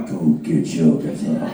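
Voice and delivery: laughing low [name] imitation; [name] impression. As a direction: mumble it like a dazed rock star. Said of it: "And [name]'s like".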